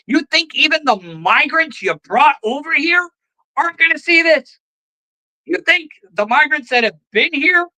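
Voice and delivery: angry rant at the Democrats, voice climbing; outraged rant. Real, not acted. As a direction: push it up into an incredulous near-shout. Ranting at Democrats, rising outrage.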